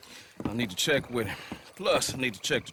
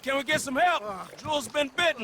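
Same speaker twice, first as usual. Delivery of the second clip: much higher, loud urgent call across the dock; calling for help; 12 semitones higher, 4 dB louder than usual. Acted. Thomas calling to the dock folk for help with bitten Jules, loud urgent call. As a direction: shout for help, desperate for someone to hear.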